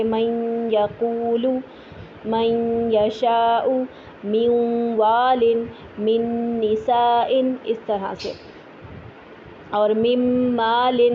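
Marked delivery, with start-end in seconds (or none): 0.0-7.3 noon merged into next letter, nasal hum; slow Quranic recitation merging the noon; idgham with ghunna. Shown as a demonstration. Teacher showing idgham with slow nasal recitation. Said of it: "جیسے". 9.7-11.1 من مال slowly merged with ghunna; slow nasal merging of noon; idgham into meem. Shown as a demonstration. Teacher reciting an idgham example. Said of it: "میم آ جائے تو".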